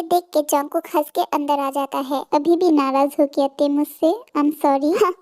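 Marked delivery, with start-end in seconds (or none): none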